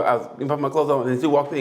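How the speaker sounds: usual speaking voice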